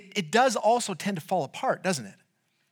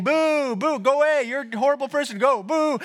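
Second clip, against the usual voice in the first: higher, heckling mock boos; crowd booing; 6 semitones higher, 3 dB louder than usual. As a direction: jeer like an annoyed movie audience. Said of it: "All of us are saying".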